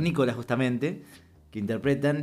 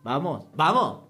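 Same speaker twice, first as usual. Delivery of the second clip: calling an animal; 3 semitones higher, slower than usual. Acted. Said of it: "cuando vos le decís".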